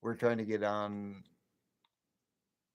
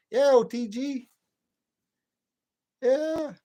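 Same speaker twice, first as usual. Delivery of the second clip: excited call; 12 dB louder, slower than usual. Real, not acted. Calling out to chat.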